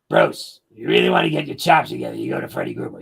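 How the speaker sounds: lightly imitating an old drummer's deep rough voice; deep rough bass voice. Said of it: "one of those rough, really deep, resonant bass voices".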